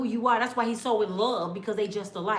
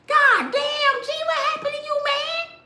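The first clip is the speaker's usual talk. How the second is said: much higher, slow and drawn-out horrified cry; high-pitched shocked brother; 4 dB louder than usual. Retold or imitated. Brother sees how thin the visitor is, much higher slow shocked voice. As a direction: squeak it out like a grown man who has lost his voice from shock. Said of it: "He get a high-pitched voice".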